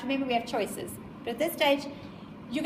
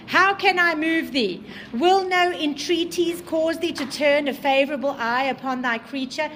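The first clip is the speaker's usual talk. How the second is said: dramatic reading; 2 semitones higher, 6 dB louder than usual. Acted. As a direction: declaim the monster's plea to the class. Reading the creature's plea aloud.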